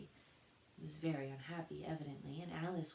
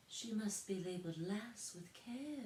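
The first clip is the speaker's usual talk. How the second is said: gentle voice; 5 semitones higher than usual. A narrator's reading. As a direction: speak gently from far off. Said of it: "a very gentle voice in the distance".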